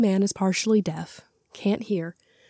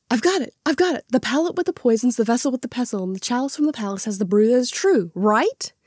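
rapid, higher recital; fast tongue-twister recital; 3 semitones higher, faster than usual. Retold or imitated. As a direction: rattle it off quickly. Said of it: "And it's".

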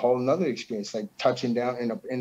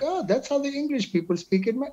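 much higher, put-on European fan voice; foreign fan impression; 13 semitones higher than usual. Imitating a European fan reacting to his accent, high put-on voice. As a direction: mimic a foreign fan hearing English. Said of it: "doing your accent".

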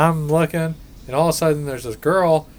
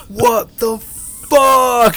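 slow mock yell, pitched up; retold yell; 9 semitones higher, 4 dB louder, much slower than usual. Friend covered in glitter, slow mock yell. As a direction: drag out the yell in surprise. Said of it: "He starts yelling".

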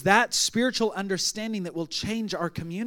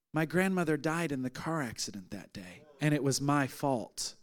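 low, soft and subdued, imitating her grief; understated sorrow, no real tears; retold tearful confession; 6 semitones lower, 7 dB quieter than usual. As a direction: quietly voice a grieving woman's confession, slowing at the end. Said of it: "she just starts crying".

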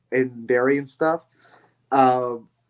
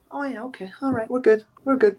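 acted reassurance; 10 semitones higher than usual. Acts out being reassured by someone.

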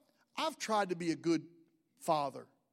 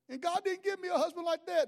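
higher, put-on woman's voice; mock lady voice; 9 semitones higher than usual. Jealous lady apologizing to Sherry, higher mock voice.

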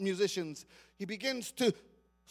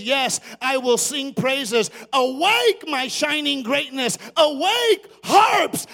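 higher, louder cry of praise; triumphant shout; 4 semitones higher, 14 dB louder, slower than usual. Retold or imitated. Preacher crying David's praise over the music. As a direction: shout the dawn awake. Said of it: "and he says".

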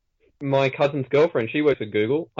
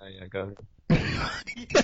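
low, slow, half-hearted go-ahead; barely interested, soft and slow; unexcited; 6 semitones lower, 7 dB quieter, much slower than usual. Real, not acted. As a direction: let it drag without any enthusiasm. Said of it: "why are you not sounding excited".

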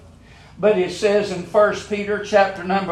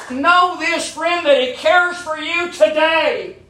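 each line climbs higher in pitch; preacher building to a peak; building sermon; 6 semitones higher than usual. Acted. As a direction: drive the repetition upward.

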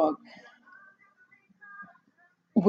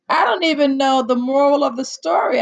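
exasperated outburst; 6 semitones higher, 5 dB louder than usual. Real, not acted.

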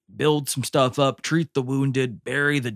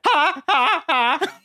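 high, stiff ha-ha like an unlearned laugh; robotic fake laugh; much slower than usual. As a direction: laugh like a robot still learning how. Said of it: "weird laughs where they haven't learned".

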